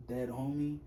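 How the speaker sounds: normal talking voice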